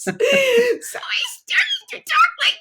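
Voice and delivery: very high, slow squeaky tick; tiny tick voice. Acted. Author demonstrating her audiobook tick, tiny high-pitched voice. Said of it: "speak in this high-pitched, tiny voice".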